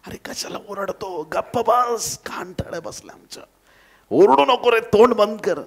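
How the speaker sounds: high-pitched irritated telling-off; scolding. Acted. Crowd orders the blind man to stop shouting, scolding. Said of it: "अनेकांनी त्याला धमकावले".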